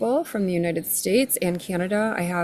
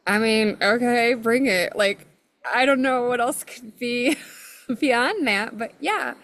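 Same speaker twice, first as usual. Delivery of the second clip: game but incredulous; 5 semitones higher than usual. Retold or imitated. Her retold game reply to plants.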